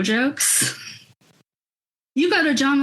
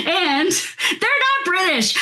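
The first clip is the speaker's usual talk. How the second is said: laughing; 10 semitones higher, much slower than usual. Real, not acted. Laughs at her own mix-up.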